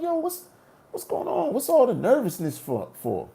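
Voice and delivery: high uneasy questioning; alarmed. Retold or imitated. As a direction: voice jumps up in alarm. Strangers streaming out at 2am.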